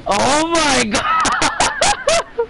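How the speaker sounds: high, slow drawn-out laughing "Oh my god"; laughing disbelief. Real, not acted. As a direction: crack up in disbelief. Laughing at sudden death.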